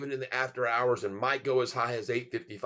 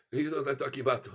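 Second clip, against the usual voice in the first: ordinary talk, nothing marked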